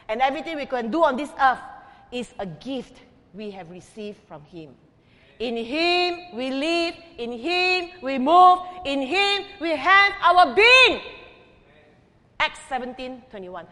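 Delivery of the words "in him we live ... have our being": slow, rising, drawn-out recitation; intoned scripture. Acted. Preacher slowly intoning the verse to the congregation. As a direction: intone each phrase slowly and build. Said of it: "preacher intones scripture verse".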